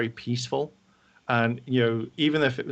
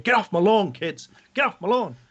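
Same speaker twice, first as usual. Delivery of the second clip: higher, louder fist-shaking get-off-my-lawn yell; grumpy old man; 8 semitones higher, 4 dB louder than usual. Retold or imitated. Trill elder yelling at the intruders, mock grumpy old man. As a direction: shake your fist at the kids. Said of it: "shaking his fist out going".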